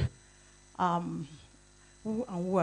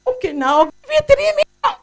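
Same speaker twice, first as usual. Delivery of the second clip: much higher, much louder booming voice; senator's big offer; 17 dB louder than usual. Retold or imitated. Senator grandly announcing three million, high and very loud. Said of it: "You know, like that".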